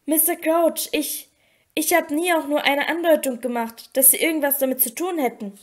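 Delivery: usual speaking voice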